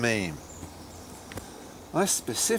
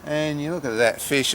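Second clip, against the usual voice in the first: normal talking voice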